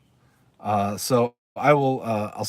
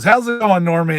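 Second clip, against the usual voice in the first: loud, higher, cheerful bar greeting; Sam greeting; 6 semitones higher, 8 dB louder than usual. Retold or imitated. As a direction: call it out across the bar to a regular. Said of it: "And Sam goes".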